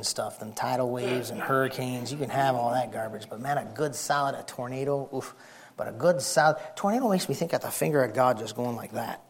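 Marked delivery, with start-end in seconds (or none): none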